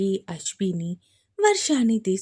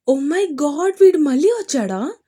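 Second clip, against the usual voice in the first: alarmed gasp; 6 semitones higher, 7 dB louder than usual. Acted. Sister hears bad news.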